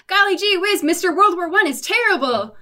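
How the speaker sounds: high, corny gee-whiz voice; wholesome 1950s kid. Retold or imitated. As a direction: play it like a clean-cut movie kid. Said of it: "no one talks like that in a trench".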